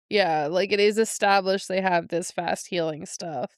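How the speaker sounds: ordinary talk, nothing marked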